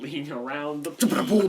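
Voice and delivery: ordinary talk, nothing marked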